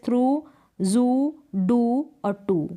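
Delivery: थ्रू जू डू और टू said slowly, each with the same long oo sound; slow rhyme demo. Shown as a demonstration. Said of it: "तो ऐसे आपको practice करनी है". (तुकबंदी वाले शब्द धीरे-धीरे)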